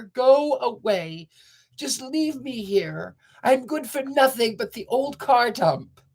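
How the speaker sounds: high, slow and forlorn; despairing old car. A narrator's reading. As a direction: plead to be left alone, slowly.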